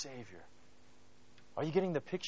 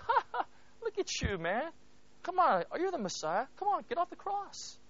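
high, jeering mock laughter and taunts; 10 semitones higher than usual. Enemies at the cross taunt Jesus, high jeering mockery. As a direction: sneer up at him like a heckler. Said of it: "They were saying".

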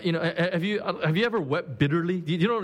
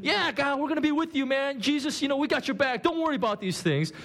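higher, eager buddy voices; eager disciples; 9 semitones higher than usual. Retold or imitated. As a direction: play the disciples all pumped up. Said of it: "They were all saying".

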